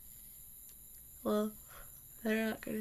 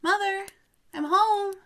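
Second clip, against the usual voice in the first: loud, higher call through the house; 9 semitones higher, 12 dB louder than usual. Acted. Daughter calling to mother on arriving home, loud. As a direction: announce you're home.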